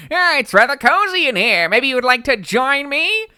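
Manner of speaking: high, loud put-on creature voice; sultry creature voice. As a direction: tease them into joining you. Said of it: "What do you think she would say?".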